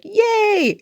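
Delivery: squealed high yay; a sudden delighted squeal. Retold or imitated. Mock cheer over nine likes, squealed high. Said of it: "I'm like".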